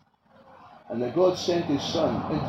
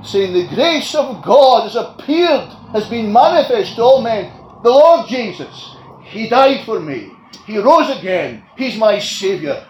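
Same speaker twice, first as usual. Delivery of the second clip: rousing preaching; 6 semitones higher, 10 dB louder than usual. Acted. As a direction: ring out the praise of the redeemed. Preacher voicing heaven's praise.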